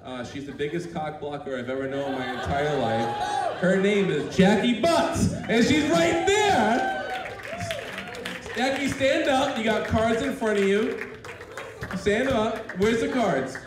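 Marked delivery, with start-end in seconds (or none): 3.6-13.6 loud, high showman's call; calling out to the crowd. Real, not acted. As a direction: announce her to the room, loud and slow. Calling out friend in audience.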